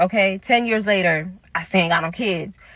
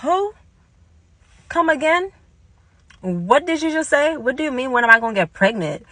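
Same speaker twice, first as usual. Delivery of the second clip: high incredulous questions, starting high and easing down; retold disbelief; 5 semitones higher than usual. Teller to friend who asked about pregnancy, retold disbelief. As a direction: act out being taken aback.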